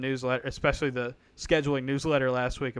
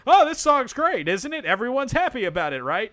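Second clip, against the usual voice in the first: mock cheerful SEC; 9 semitones higher, 5 dB louder than usual. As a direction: sell it like a naive PR voice. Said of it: "it was".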